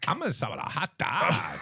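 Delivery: comedic Mexican accent, lightly put on; a jokey chihuahua with a Mexican accent. Chihuahua ordering a hot dog in a comedic Mexican accent. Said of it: "That was Mexican".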